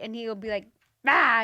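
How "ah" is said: loud exasperated "ah"; mock irritated groan. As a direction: imitate his irritated outburst. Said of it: "and he gets all irritated".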